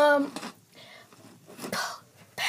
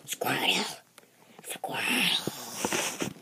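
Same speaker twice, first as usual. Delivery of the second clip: whisper; 13 semitones lower, 14 dB quieter, much slower than usual. Pretend. Whispering pokemon name to card.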